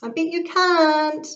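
high, loud challenge; playful shouted dare; shouting. Acted. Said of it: "I shouted".

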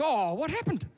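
concerned dad. Acted. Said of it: "Mike goes".

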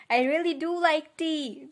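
shouted from the bottom of the steps; shouting. Acted. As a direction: call it up the stairs. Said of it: "James shouted from the bottom of the steps".

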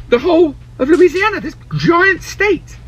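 exaggerated awe. Real, not acted. Comic emphasis of size.

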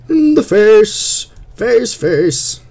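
high, loud battle yell; gleeful shout. Real, not acted. Player shooting two mobs, yelling triumphantly. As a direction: yell it as you fire.

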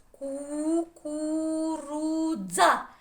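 high, loud, very slow «Кукурудза»; протяжно по складах. Shown as a demonstration. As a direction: stretch every syllable of «Кукурудза», rising to the end. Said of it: "І ще раз". (високо, голосно, розтягуючи склади)

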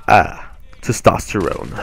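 slow, put-on macho drawl of testosterone; mock manly voice. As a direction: say testosterone like a parody of a tough guy.

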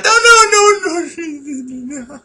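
very high squeal, loud then fading; chillido de pánico. Pretend. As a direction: burst out squealing, then trail away. Panicked squeal mid game. (chillido agudísimo que se va apagando)

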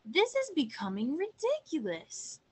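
mildly worked-up storybook protest; flustered. A narrator's reading. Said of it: "cried the Google-eyed goose in an even bigger flap".

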